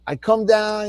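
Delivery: ordinary talk, nothing marked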